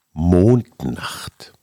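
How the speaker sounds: slow, hushed poem reading; poem recitation. Acted.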